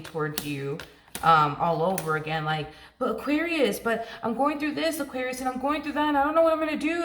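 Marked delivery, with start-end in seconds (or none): 3.0-7.0 high-pitched, whiny plea for help, put on; hammed-up overwhelmed begging; mock desperate pleading. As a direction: imitate someone frantic and helpless. Imitates person pleading for rescue.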